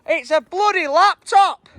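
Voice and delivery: high, loud and slow; slowed-down thrilled reveal; excited discovery. Real, not acted. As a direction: announce the find in disbelief. Surprise find in rubbish bag.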